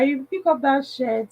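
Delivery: ordinary talk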